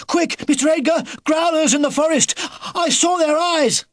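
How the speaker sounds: high, loud frantic calling; urgent shout. A narrator's reading. Philip calling Mister Edgar to the window, loud and high. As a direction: yell for help, breathless with alarm. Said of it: "he shouted".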